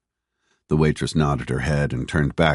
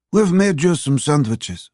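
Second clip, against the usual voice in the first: put-on high, slow, accented waitress voice; older Russian waitress, pitched well up; 12 semitones higher, 4 dB louder than usual. A narrator's reading. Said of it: "the waitress said to Phoebe in Russian".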